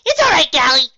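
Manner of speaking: high, loud bubbly voice; Pinkie cheerful. Acted. Pinkie reassuring Galahad, high and loud. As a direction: bounce it out brightly.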